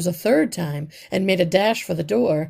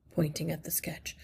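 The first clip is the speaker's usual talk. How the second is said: ordinary delivery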